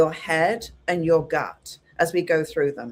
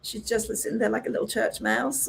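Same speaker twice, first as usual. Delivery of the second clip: lightly hushed, softer voice; soft as a little church mouse; a hint of a timid whisperer; meek church mouse; 3 semitones higher, 6 dB quieter than usual. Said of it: "is she whispering?".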